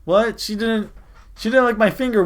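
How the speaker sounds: high, loud disbelieving yelp; shocked outburst. Real, not acted. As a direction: blurt it out in real shock. Shocked reaction to game line.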